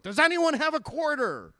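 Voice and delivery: high, loud mock plea to a crowd; calling out. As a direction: shout it out as a comic appeal. Calling out at burning car wash.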